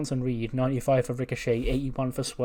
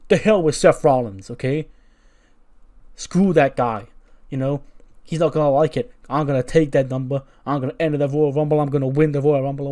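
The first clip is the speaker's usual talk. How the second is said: higher, louder furious boast; hammed-up furious wrestler vowing revenge; angry rant; 3 semitones higher, 6 dB louder than usual. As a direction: rant like a raging brute. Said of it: "He goes".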